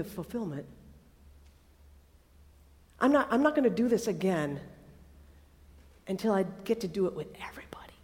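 low, soft voice, fading almost to a whisper; solemn, hushed. Acted. Jesus to his disciples at the last supper, solemn and hushed. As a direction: speak gently, with long pauses between phrases.

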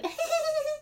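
put-on high, thin childlike giggle; creepy child giggle. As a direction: giggle like a spooky little kid.

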